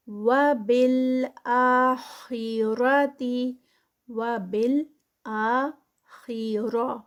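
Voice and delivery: وبالآخرہ with heavy خا and را; slow recitation. Shown as a demonstration. Teacher models heavy letters, slow chant. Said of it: "خا بھی heavy".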